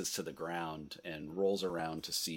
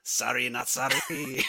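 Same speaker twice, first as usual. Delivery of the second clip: gleeful mock yell; 6 semitones higher, 4 dB louder than usual. Game master playing the player's character bursting from the underbrush, mock yelling. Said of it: "burst out of the underbrush yelling".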